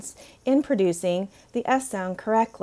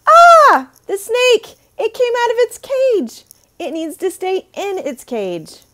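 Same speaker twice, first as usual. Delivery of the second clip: put-on much higher, louder, playful alarm to a child; playful teacher voice; 13 semitones higher, 11 dB louder than usual. Shown as a demonstration. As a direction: gasp at the escaped snake for a kid. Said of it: "you can say".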